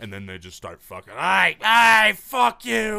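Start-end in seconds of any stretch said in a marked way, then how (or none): 1.1-3.0 very high, loud shouting; hammed-up street-fight holler; mock brawler yelling. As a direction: imitate a drunk starting a fight. Acts out drunk street brawlers.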